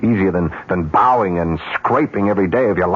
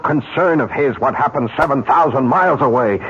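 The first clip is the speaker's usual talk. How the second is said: rising agitation; 8 semitones higher than usual. Acted. Arguing with Lou about the call, voice rising to a near shout. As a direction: push harder and higher until you nearly shout call. Heated argument, voice building.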